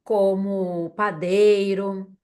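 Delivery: each word drawn out very slowly; slow and deliberate. Shown as a demonstration. Teacher slowly spelling out the sentence pattern for students. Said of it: "pra falar que eu trabalho".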